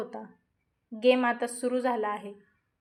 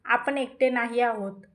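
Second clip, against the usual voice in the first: plain reading voice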